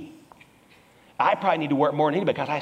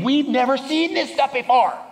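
high, full-voiced amazement; amazed; 7 semitones higher, 3 dB louder than usual. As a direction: exclaim it like an astonished onlooker. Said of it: "they'll say the same thing".

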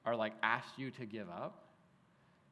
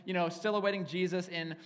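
much higher and louder, savouring the epic scene; vivid excitement; 7 semitones higher, 6 dB louder than usual. Real, not acted. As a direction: paint a grand royal entrance.

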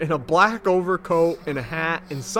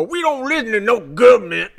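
mock tough guy; 7 semitones higher, 7 dB louder than usual. Mocking the burn victim dude.

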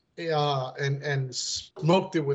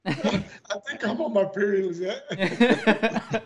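giggling mock confession; laughing; 2 semitones higher, slower than usual. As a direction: laugh it out to Lizette. Joking impersonation, laughing.

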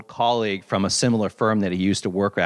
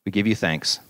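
closing prayer; 5 semitones lower than usual. Acted. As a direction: bring the prayer gently home.

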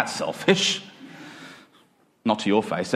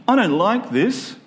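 whinging; 8 semitones higher than usual. Acted.

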